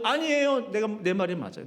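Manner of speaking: a hint of a pouting, insistent Jonah; stubborn Jonah. Jonah contradicting God, lightly voiced stubbornness. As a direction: refuse to back down. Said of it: "아닌데라고 해도".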